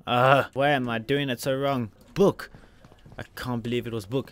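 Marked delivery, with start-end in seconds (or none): 0.1-2.5 loud, slow exasperated groan rising to a high shout; frustrated outburst. Real, not acted. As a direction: vent at your own mistake.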